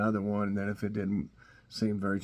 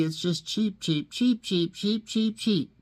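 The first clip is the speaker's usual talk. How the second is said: much higher, squeaky cheep cheep cheep; playful cheeping, far higher; mock dog cheeping; 9 semitones higher, slower than usual. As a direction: chirp it like a tiny bird. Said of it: "is cheeping like crazy".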